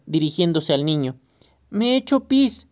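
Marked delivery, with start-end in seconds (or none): 1.7-2.7 lightly voiced small child whimpering, higher; a hint of a wet, weepy toddler; crying little boy. A narrator's reading. Small boy who wet himself, whining high and mildly tearful. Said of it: "seguía llorando el pequeño".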